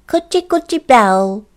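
a light goblin chant; goblin words. A narrator's reading. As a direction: say the odd magic words brightly. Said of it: "said something which sounded like".

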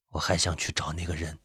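low, very soft voice; hushed, low husband; subdued resolve. A narrator's reading. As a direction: voice the wish quietly. Dying husband quietly states his wish.

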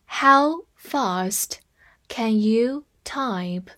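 slow, in two parts; slow reading. Shown as a demonstration. Teacher reading slowly to students, in chunks. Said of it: "我们慢慢来读一下".

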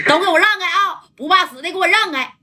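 high, forceful warning yell; shouting the crowd off. Retold or imitated. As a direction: order the mob out of the way. (高声喝令让开)

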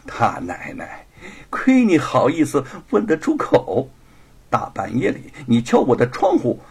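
teasing, high and loud comeback; coquettish mockery. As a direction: needle the rival with coy scorn. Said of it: "白雪燕儿娇笑起来". (娇声讥讽)

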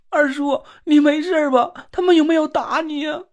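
high, crying and anxious; tearful worry. Acted. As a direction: sob out your worry for him. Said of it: "哭得很伤心".

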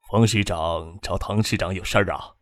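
low, soft character voice; low-voiced official. A narrator's reading. 张之行在办公室招呼副市长. (低沉轻声地询问)

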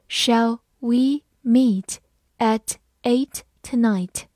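slow, in two chunks; slow reading. Shown as a demonstration. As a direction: read it slowly so students can repeat. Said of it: "好，慢慢来读。".

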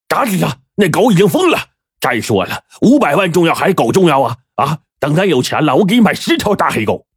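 high, pushy persuasion; impatient boss. A narrator's reading. Boss persuades his brother-in-law to kill the dog, impatient. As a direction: talk him round, impatient.

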